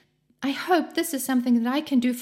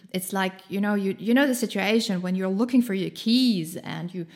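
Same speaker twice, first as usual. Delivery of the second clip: normal talking voice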